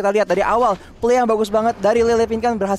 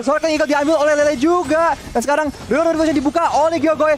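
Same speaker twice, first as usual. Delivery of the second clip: esports caster hype; 6 semitones higher than usual. Acted. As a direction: call the fight with rising hype. Teamfight play-by-play.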